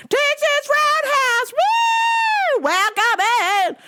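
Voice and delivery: very high, slow drawn-out holler; squealed whoop inside a shouted welcome; mock screaming. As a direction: imitate an obnoxious looping tape at full squeal. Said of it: "them screaming".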